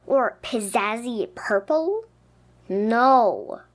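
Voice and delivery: ordinary delivery, nothing marked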